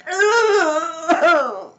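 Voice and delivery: high, loud, long wail; drawn-out scream that falls away. A narrator's reading. Missus Ralph bites the fish, screaming. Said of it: "she screamed".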